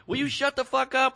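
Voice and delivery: high-pitched exasperated outburst at hecklers; lost it. Retold or imitated. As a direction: snap at chatterers, voice jumping up. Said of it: "I just lost it".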